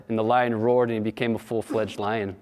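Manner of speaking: usual speaking voice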